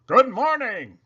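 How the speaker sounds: loud, high hearty hello; shouted greeting. A narrator's reading. Girl playing Teddy Roosevelt gallops on stage shouting hello. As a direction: boom it out to the whole hall. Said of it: "she shouted".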